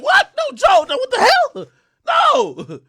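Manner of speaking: high, squeaky mock disbelief; shocked disbelief. Reacting to Joe's calm reply, high mock shock. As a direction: yelp it out in outrage at a friend. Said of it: "I'm like".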